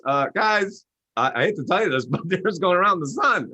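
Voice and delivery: high, apologetic bad news; Galileo. Galileo reluctantly tells crowd the earth circles the sun. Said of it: "Galileo comes along ... he goes".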